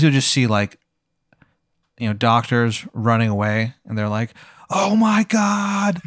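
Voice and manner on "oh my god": drawn-out, high cry of mild alarm; mock-alarmed, long and high; mock panic. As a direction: stretch it out high, like a fleeing witness. Doctors running away in alarm.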